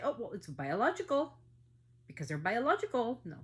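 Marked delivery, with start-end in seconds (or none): 0.0-1.3 mocking quote. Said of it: "they just say".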